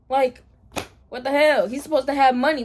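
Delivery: loud indignant rant. Real, not acted. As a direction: complain loudly.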